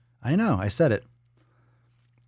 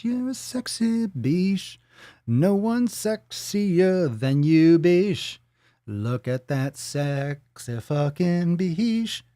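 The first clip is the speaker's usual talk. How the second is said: slow, drawn-out, higher-pitched pet praise; long, cooing vowels for a beloved pet; 3 semitones higher, much slower than usual. Real, not acted. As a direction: stretch each word out lovingly to the dog.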